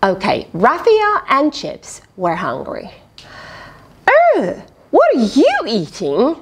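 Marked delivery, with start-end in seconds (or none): 4.2-6.4 bright-eyed curious question; curious character voice. Acted. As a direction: ask it like an eager small animal. Character voiced in picture book.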